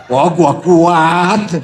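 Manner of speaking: loud, drawn-out, slow declaration; hammed-up show of toughness; mock strong boast. Mimics leaders refusing to give up.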